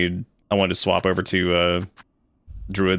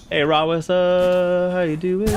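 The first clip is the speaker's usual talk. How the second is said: high, slow sing-song hello to an NPC; baby-talk hello, pitched way up; cutesy high greeting; 9 semitones higher, slower than usual. Pretend. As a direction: coo a greeting up high, drawn out. Greets a game character cutely.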